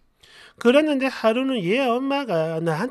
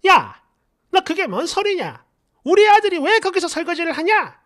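high, loud indignant yell; retold yell of an aunt defending her son; 6 semitones higher, 5 dB louder than usual. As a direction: shriek it like an outraged aunt. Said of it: "소리를 고래고래 질러요".

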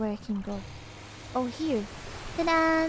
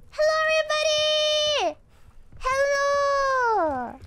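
high, long-held hello; excited greeting; 11 semitones higher, 3 dB louder, slower than usual. Real, not acted. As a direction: call out to a newly arrived crowd. Greets the raiders.

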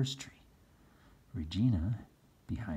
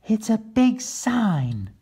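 put-on high, slow, louder boy voice; a boy stating the obvious; Chris voice; 13 semitones higher, 8 dB louder, slower than usual. Son Chris telling his father in high childish voice. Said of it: "said Chris".

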